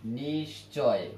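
নিশ্চয় drawn out slowly as nish-choy; নিশ্চয় stretched out, both syllables clear; pronunciation demonstration. Shown as a demonstration. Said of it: "আমরা বলি নিশ্চয়".

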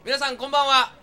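bright, loud call to the crowd; loud greeting. Real, not acted. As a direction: call out to the audience. (観客への大きな呼びかけ)

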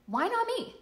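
"why not me?" lifted high with a bright rise; hopeful why not me. Shown as a demonstration. Coach voicing the inspired, hopeful version.

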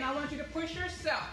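ordinary talk

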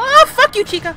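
high fright yell at Chica; real scared yell; startled yell. Real, not acted. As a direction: shout from a fright.

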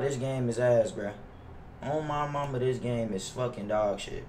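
normal talking voice

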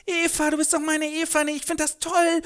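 viel höhere, verzückte Mädchenstimme; schwärm Eva quietschfidel an; entzückte Mieze. Acted. (Mieze entzückt zu Eva, sehr hoch)

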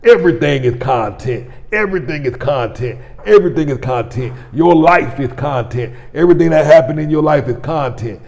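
preaching. Retold or imitated. Father to his household about content, preaching emphatically. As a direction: preach it like a household motto, hitting each repeat. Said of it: "they hear me preach all day long".

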